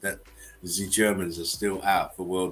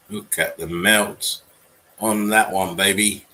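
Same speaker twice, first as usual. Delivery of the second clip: slow, louder, savouring each word; relishing it; 7 dB louder, slower than usual. Real, not acted. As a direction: stretch it out like a showman gloating. Marvelling at melted building.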